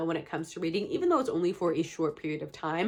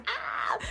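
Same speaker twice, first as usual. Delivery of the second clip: frustrated squeal. Real, not acted. Frustrated at own voice.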